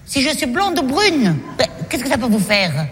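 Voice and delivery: ordinary talk, nothing marked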